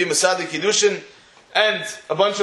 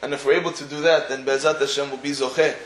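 sermon blessing; 6 semitones lower, 3 dB quieter than usual. Real, not acted. Closing blessing, lower and softer.

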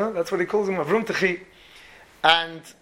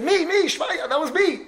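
eager reply; 11 semitones higher than usual. Retold or imitated. Eager answer to the officer.

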